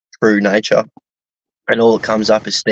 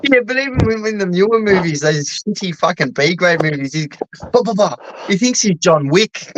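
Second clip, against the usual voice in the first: laughing; 8 semitones higher than usual. Real, not acted. Laughing mockery of Seagal.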